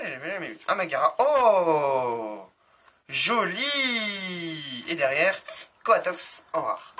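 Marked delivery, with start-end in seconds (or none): 1.2-4.8 surprised. Real, not acted. Nice card revealed.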